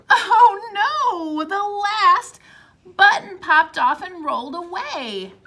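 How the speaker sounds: normal talking voice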